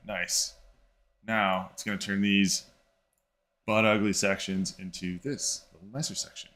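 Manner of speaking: ordinary delivery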